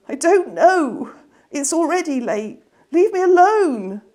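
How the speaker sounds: high, loud, fed-up snapping; upset girl. Acted. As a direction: Wren pushes her brother away, high and cross. Upset girl snaps at brother.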